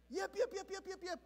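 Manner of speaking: a hint of the yippy dog; lightly yapped dog imitation; small dog yaps, half-done; lighter chihuahua yap.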